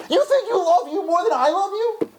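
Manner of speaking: God pleading to be understood; overdone divine disbelief; exaggerated incredulous God. God answers, stronger take.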